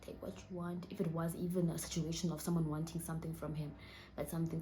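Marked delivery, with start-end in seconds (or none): 0.0-0.9 hushed, pleading for his life; soft, halting plea as imagined from the father; imagined begging. Retold or imitated. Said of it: "probably begging for his life".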